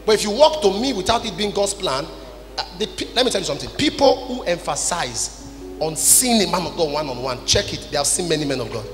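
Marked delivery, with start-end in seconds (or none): none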